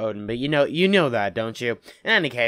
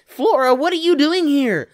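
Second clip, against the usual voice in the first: shocked; 10 semitones higher, 7 dB louder, slower than usual. Acted. Flora's shocked question, repeated louder.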